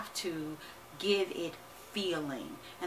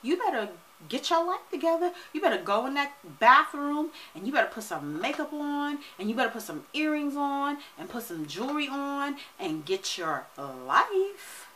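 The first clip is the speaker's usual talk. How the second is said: much higher, sassy scolding; sassy scold, get it together; Spirit scolding; 7 semitones higher than usual. Retold or imitated. Spirit scolding her to get ready, high and sassy. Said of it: "And Spirit said".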